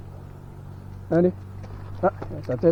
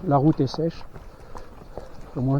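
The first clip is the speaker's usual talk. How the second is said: ordinary talk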